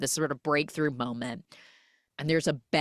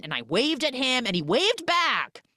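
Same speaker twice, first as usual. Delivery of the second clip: high, rising distressed report; acting out panicked disbelief; frantic; 8 semitones higher than usual. Retold frantic report to partner.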